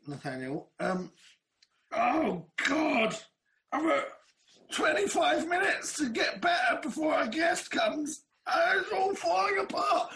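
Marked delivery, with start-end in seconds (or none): 1.9-10.1 mock panic. Real, not acted. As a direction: wail in comic despair. Panicking before the guest arrives.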